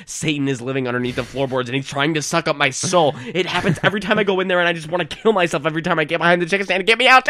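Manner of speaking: fast, rising to a loud yell; frantic rant. Pretend. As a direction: unload it all in one breathless rant. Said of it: "to not say".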